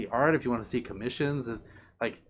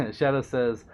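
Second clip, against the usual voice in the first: usual speaking voice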